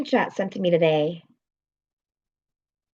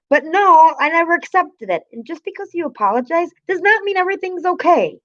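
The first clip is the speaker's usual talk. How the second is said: words breaking with tears, voice up high; crying; 8 semitones higher than usual. Real, not acted. Streamer to her chat about the apology, tearful and crying. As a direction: let the tears come through as she insists. Crying while recounting the hurt.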